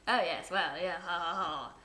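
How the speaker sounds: hammed-up posh English toff; comically posh English. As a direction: put on a silly upper-class English voice. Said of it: "comically posh".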